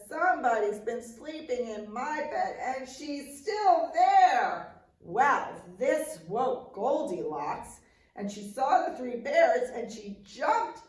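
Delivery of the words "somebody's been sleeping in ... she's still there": put-on Baby Bear voice. A narrator's reading. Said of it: "And Baby Bear says".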